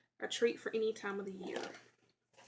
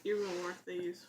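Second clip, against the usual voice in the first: words muffled by a mouth full of candy; 4 semitones lower than usual. Real, not acted. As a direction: still chewing, mouth full. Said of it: "sorry, talking with my mouth full".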